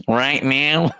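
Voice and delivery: lightly imitating a military spokesman; a hint of a stiff spokesman voice; mock spokesman. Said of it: "he would still be able to say".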